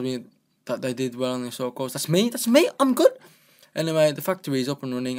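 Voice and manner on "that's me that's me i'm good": high, loud proud squeal; excited boast. Real, not acted. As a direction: brag gleefully about yourself. Proud reaction reading diary.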